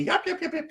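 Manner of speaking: high yapping yips; coyote yips. Retold or imitated. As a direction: yip like a coyote pup. Imitating coyote yips from website.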